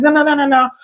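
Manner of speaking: high protesting wife voice, rushing the last line; high hurried wife protest. Retold or imitated. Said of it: "She's like".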